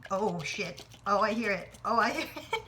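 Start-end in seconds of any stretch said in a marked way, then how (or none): 1.8-2.7 high giggling relief; excited laugh. Real, not acted. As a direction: laugh it out as it slides. Laughing as flan drops.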